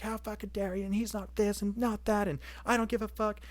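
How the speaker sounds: high, soft mock scream; retold screaming. Sophia screaming at her parents, imitated softly and high. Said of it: "she's, like, screaming".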